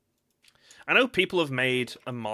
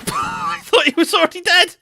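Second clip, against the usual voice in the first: high, loud laughing shriek; shocked laughter; 13 dB louder than usual. Real, not acted. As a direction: shout it through a burst of laughter. Shocked mob was alive, laughing.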